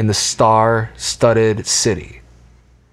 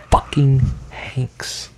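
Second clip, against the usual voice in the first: whispering; 2 semitones higher, 3 dB quieter, much slower than usual. Acted. Awed whisper of his name.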